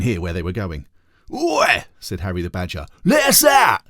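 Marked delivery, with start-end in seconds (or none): 1.3-1.9 very high, loud call; shouted "Oi!". Acted. Harry calls from jail. 3.0-3.8 very high and loud; jailed badger yelling to be let out; demanding shout. Harry calls from jail.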